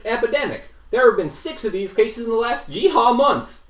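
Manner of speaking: high twangy cowboy sheriff voice; hammy yeehaw robot lawman. Acted.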